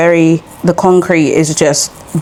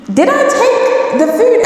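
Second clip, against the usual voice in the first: very high, slow, drawn-out question; mock bafflement; slower than usual. Asking the shopkeeper about missing chips, baffled. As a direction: act out baffled disbelief, pitched right up.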